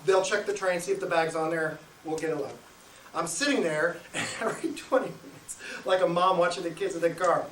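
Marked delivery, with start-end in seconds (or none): none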